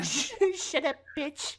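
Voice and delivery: mimicking little Joe, high and squeaky; squeaky kid voice. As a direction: play a tiny kid mouthing off. Quote redone much higher.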